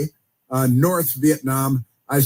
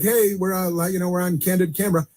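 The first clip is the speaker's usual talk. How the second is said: higher, quick stage-whispered aside to his wife; mock politician aside; 5 semitones higher than usual. President to his wife in front of cameras, a light mock aside. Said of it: "looks at his wife and is like".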